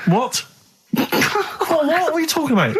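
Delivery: high, incredulous, laughing disbelief; laughing disbelief, voice shooting up. Real, not acted. Co-host hears the quiz is coming back, incredulous and high.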